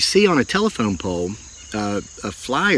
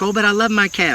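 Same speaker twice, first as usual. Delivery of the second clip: lightly imitated young woman, pitched up high; mock her pleading, higher than his own voice; 11 semitones higher than usual. Retelling the cat owner's protest, in a higher mock young woman voice. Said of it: "And she's like".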